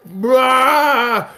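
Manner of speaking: mock exasperated bruh. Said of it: "They're like".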